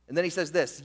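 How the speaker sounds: ordinary delivery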